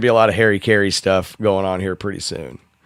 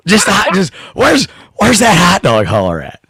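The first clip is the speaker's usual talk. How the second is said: loud, high announcer voice; Harry Carey voice; 6 semitones higher, 4 dB louder than usual. Pretend. Prank call to the shop kid, loud high announcer voice. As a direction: bellow it like an old ballpark announcer. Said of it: "gonna call up and be like".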